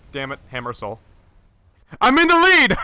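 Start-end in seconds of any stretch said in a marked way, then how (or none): 2.0-2.8 very high, loud triumphant yell; excited shout. Real, not acted. Player suddenly in first place, excited shout. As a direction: yell it in sheer glee.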